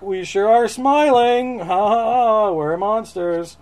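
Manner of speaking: hammed-up happy monsters; jolly monster voice. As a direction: fake a drawn-out ha ha ha. Monsters grinning in retold story.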